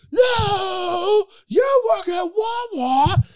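very high, loud, drawn-out wail; hammed-up screeching argument; mock outraged shriek. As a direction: stretch every word into a squealed comeback. Acted quarrel at its peak.

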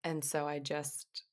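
ordinary talk

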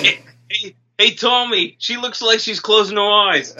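high-pitched Boston bro; hammed-up Boston caricature; Boston accent. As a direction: alert your buddy in a mock Boston accent. Acting out Boston men calling out.

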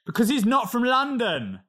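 retold indignant correction; indignant retort. Retold argument with cruise guest.

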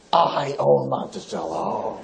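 low, slow, soft menacing boast; lightly voiced boss, half laughing; powerful owner. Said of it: "And he's like".

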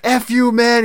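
high, loud pissed-off snap; mock angry comeback from Eddie to the referee; mock angry retort. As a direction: fire it back like an offended wrestler. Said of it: "Eddie got pissed off".

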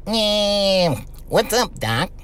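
put-on cartoon catchphrase voice; cartoon character voice. Radio host demonstrating his voices, cartoon impression. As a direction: do a cartoon wisecracker's greeting. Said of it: "I was doing voices".

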